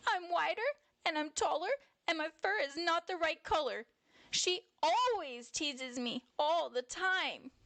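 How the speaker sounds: high-pitched, upset little bear pouring it out; crying out. A narrator's reading. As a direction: complain in a high, hurt child voice. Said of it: "Jazzy Bear cried, interrupting their conversation".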